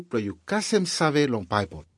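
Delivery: ordinary delivery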